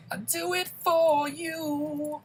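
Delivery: very high, slow and laughing; a goofy high-pitched promise through a laugh; laughing silly voice. Real, not acted.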